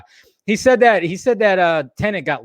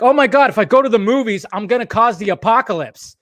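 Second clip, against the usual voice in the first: higher-pitched, hammed-up panic; mock alarm; 6 semitones higher, 3 dB louder than usual. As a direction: mimic an overdramatic doomsayer. Mocking fearful moviegoers' shaming.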